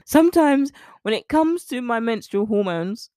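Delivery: usual speaking voice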